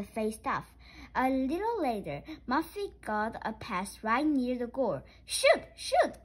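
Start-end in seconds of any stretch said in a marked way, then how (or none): 5.2-6.2 loud high urgent cry; cried out. A narrator's reading. Arthur urging Muffy near the goal, loud cry. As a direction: yell it urgently. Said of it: "cried Arthur".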